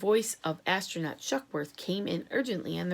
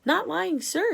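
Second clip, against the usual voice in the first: much higher, louder protest; protesting premier; 10 semitones higher, 5 dB louder, slower than usual. A narrator's reading. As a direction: insist you're innocent, voice jumping up. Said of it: "said Premier Yugatov".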